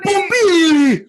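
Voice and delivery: high, drawn-out mock call; mimicked call. Host mimicking a film character calling out, high drawn-out cry. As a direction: call it out high and long, imitating.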